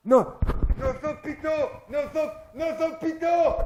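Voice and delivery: high, insistent repeated cry; mock frenzy. Comic imitating a man working himself up, frenzied. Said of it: "Il s'excitait tout seul".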